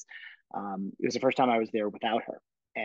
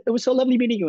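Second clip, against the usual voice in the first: choked-up bright farewell; 13 semitones higher, 8 dB louder, much faster than usual. Retold or imitated.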